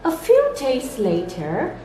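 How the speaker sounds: ordinary delivery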